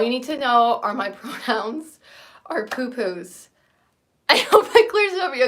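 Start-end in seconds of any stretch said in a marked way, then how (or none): 4.3-5.5 laughing. Real, not acted. Joking punchline about pronouns.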